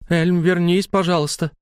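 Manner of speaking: insistent plea; demanding. A narrator's reading. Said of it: "потребовала Лайла".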